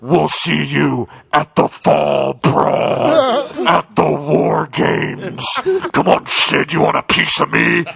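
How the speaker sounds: mock menacing wrestling voice; dramatic intro voice; Shockmaster voice. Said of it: "there's this voice".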